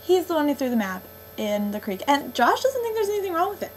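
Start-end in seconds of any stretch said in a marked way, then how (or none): 2.1-3.7 high-pitched, rushed indignation; can't believe he got away with it; indignant outburst. Real, not acted. Reviewer fuming about Mike throwing the map away, indignant.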